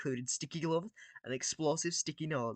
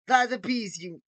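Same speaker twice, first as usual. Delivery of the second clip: put-on higher, louder villain accent try; Gru accent; 6 semitones higher, 5 dB louder, slower than usual. Villain voice rehearsal, first accented take. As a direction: test the supervillain accent. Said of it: "He has this sort of accent".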